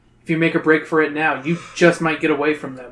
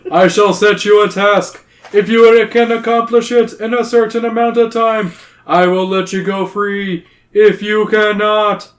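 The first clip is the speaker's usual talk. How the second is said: loud, raised, declaiming royal voice; pronouncing cave king; 5 semitones higher, 7 dB louder than usual. A narrator's reading. Cave king sets the humans a task, loud proclamation. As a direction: proclaim it grandly, slowing at the end.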